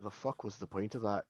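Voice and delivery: low, hushed grumble; deep dwarf voice, very quiet; unimpressed dwarf. Acted. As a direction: mutter it deep and unscared. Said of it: "He just looks at you".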